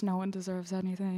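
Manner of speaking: cynical, dry Dr. House; deadpan it like House; House impression. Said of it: "That's a very House comment".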